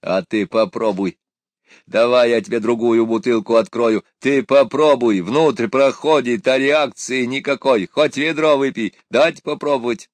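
put-on loud, high, coaxing old drunk; pushy drunk. A narrator's reading. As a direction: push the bottle on him, loud. Drunk urges boy to drink.

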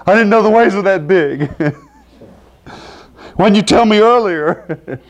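high, loud hammed-up panicked Jesus; mock panic. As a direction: play it as a comic alarmed outburst. Jesus comically voiced as panicking.

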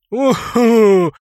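loud, high whoop of laughter; triumphant mocking hoot; gleeful whoop. A narrator's reading. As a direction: hoot with glee. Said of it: "рыцарь затрясся от смеха".

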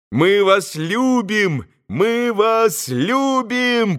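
high, long-drawn fervent cry; adoring chorus, stretched out slowly; boys joining in. A narrator's reading. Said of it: "подхватили и все".

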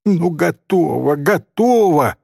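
mildly acted girl's outburst; exasperated cry. A narrator's reading. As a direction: cry out giving in. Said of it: "вскрикнула Софичка".

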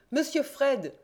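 high call across to Fred; young reporter calls to the detective. A narrator's reading. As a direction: hail him from a distance. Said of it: "cria-t-il".

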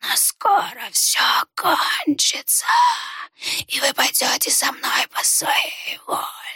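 high, slow whisper, a touch of sadness; sad rustling whisper. A narrator's reading. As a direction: rustle it sadly. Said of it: "прошелестел незнакомец".

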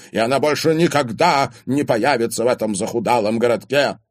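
angry scorn. A narrator's reading. Father forbids the romance, with angry scorn. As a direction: lay down the law angrily.

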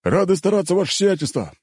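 high, forceful soldiers' response; military bark, pitched high; barked reply. A narrator's reading. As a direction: sound off like troops answering their lord. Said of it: "гаркнули бойцы".

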